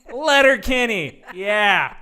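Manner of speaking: loud, laughing, drawn out; excited shout. Real, not acted. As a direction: burst out with glee.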